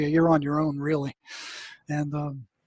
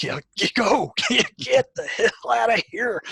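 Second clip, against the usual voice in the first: laughing; 5 semitones higher than usual. Retold or imitated. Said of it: "I'm like".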